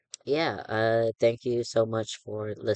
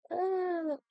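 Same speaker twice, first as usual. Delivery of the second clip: high squeal. Real, not acted. Surprised squeak at own joke.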